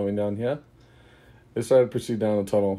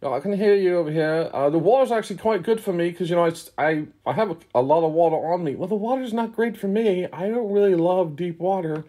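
high character voices; 9 semitones higher than usual. Acted. Party members call across the walls.

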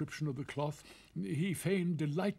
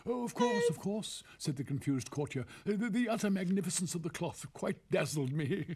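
pompous fussy courtier; 6 semitones higher than usual. Acted. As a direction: stammer out the praise as a fussy courtier. Narrator voices the confused courtier.

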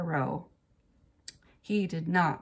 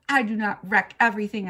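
loud, fast indignant retort; 3 semitones higher, 8 dB louder, faster than usual. A narrator's reading. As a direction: fire back a quick denial. Girl argues back after mad breath.

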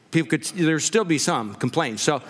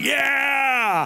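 cheering; 6 semitones higher than usual. Retold or imitated. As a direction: cheer the mud riders on. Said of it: "and I'm going".

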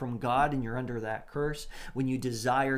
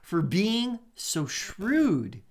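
slowly; 6 semitones higher, 4 dB louder, much slower than usual. Shown as a demonstration. Amused reading, very slow.

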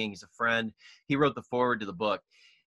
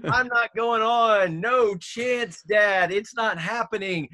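high, loud mock kid refusal; father imitates his kids begging off the stage, high and loud; protesting kids; 7 semitones higher, 3 dB louder, slower than usual. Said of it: "my kids are all giving me the cutthroat sign".

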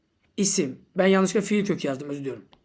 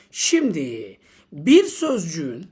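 ordinary talk, nothing marked

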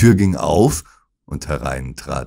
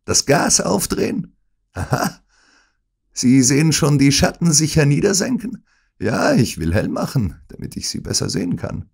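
villain taunting Holmes; mocking villain; 10 semitones higher than usual. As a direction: savour his victim's end. Smith mocks the dying Holmes.

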